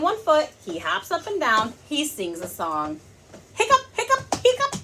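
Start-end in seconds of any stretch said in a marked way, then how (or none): 3.5-4.8 very high, squeaky mock hiccups; hiccup sounds. Mother acting the hiccups.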